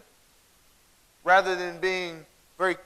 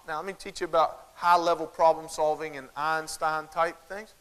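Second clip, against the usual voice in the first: ordinary talk, nothing marked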